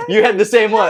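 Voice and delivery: said through laughter; laughing. Real, not acted. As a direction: laugh while pointing it out. Laughing recognition.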